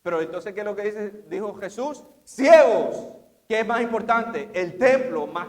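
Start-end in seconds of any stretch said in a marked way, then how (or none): 2.2-3.1 high, loud cry of blind ones; loud rebuke. Acted. Jesus rebuking the Pharisees, loud high cry. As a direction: fling the word like an accusation.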